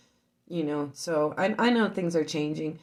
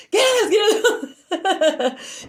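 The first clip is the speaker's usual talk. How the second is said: high shout breaking into laughter; laughing retold yell; laughing mock shout; 14 semitones higher, 4 dB louder than usual. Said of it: "I told him that".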